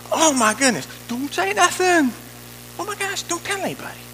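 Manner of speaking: high-pitched, panicky fussing; mock alarm. Preacher mimics the voice that wants nobody told, mock alarmed. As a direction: act a flustered plea to keep it secret.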